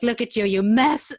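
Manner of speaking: loud, high, drawn-out comic telling-off; hammed-up self-reproach, slow and loud; mock scolding. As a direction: yell it at yourself in mock disgust. Acts out scolding own present self.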